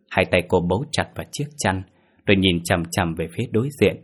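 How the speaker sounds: ordinary delivery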